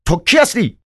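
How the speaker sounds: incensed, high-pitched challenge; angry cry. A narrator's reading. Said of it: "فریاد کشید".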